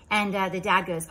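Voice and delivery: ordinary talk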